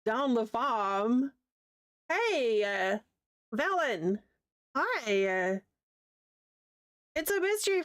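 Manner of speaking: slow, lightly hammed-up Kiwi farmer drawl; mock Kiwi farm accent. Streamer teasing her own Kiwi accent, slow mock farm drawl. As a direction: stretch it out like a Kiwi from the farm, half-joking. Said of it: "I sound like I'm from down the farm".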